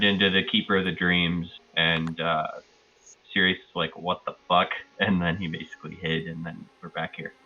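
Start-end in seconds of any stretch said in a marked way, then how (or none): none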